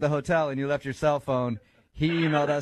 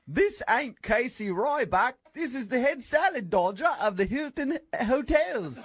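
fake British boss; 9 semitones higher than usual. Caller poses as British-accented boss.

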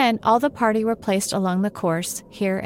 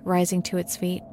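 usual speaking voice

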